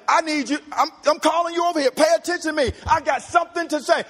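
high, urgent calling out; emphatic summons. Acted. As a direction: demand their attention right now. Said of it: "you wouldn't emphasize, like".

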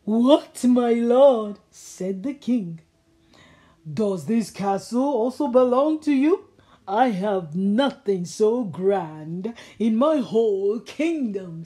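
loud, amazed royal voice; king amazed at the castle; astonished king. Acted. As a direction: marvel loudly.

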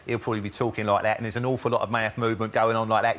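Cockney taxi driver with lots of mouth movement; old Cockney. Retold or imitated. Linguist imitating a London taxi driver, old Cockney. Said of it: "the old Cockney voices".

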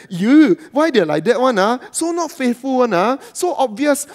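scornful, smug gossip tone; mocking judgment. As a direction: sneer down at them as if above it. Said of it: "read an account like this and say".

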